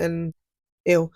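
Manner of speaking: ordinary talk, nothing marked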